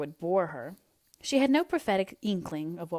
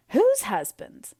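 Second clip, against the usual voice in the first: high, loud, slow outburst; shocked disbelief; 7 semitones higher, 7 dB louder, slower than usual. A narrator's reading. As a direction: gasp it out, astonished. Anne breathless, shocked.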